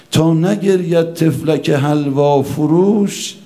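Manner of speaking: recited verse. Acted. Verse quoted in the sermon.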